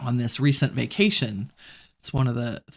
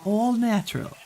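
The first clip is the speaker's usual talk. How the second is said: "All natural" drawled in a mock Southern accent; hammed-up Southern twang on "All natural"; 8 semitones higher than usual. Shown as a demonstration. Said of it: "You gotta say it in a southern accent".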